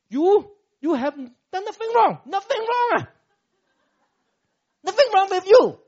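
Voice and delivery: high, loud sarcastic wife snapping back; angry wife. Retold or imitated. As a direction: hurl it back like the exasperated wife. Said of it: "wife answers husband".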